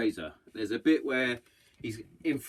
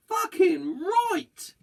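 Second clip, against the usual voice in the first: emphatic shout; 7 dB louder, much slower than usual. Real, not acted. Hails Colonel Tom's knighthood.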